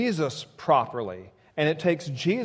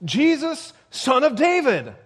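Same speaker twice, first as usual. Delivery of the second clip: high, raised cry for attention; shouted out; 9 semitones higher, 4 dB louder than usual. Retold or imitated. Blind beggar hailing Jesus of Nazareth, shouted out. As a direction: call it out high and urgent. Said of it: "but he shouted out".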